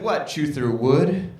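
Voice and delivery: low, drawn-out coach voice; deep slow jeer, milking the joke. Retold or imitated. Coach mocking a buck-toothed kid, low and slow. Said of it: "the coach said".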